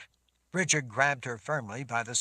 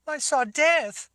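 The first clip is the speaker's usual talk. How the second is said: put-on high, slow, loud girl's voice; horrified girl; 11 dB louder, slower than usual. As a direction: say it as a terrified child. Terrified girl speaks.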